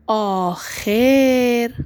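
آخر drawn out very slowly, each sound held; stretched dictation. Shown as a demonstration. Teacher dictating to young pupils, stretching آخر slowly. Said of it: "صدا کشی یادت نره".

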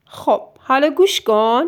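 usual speaking voice

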